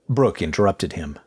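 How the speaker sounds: ordinary delivery, nothing marked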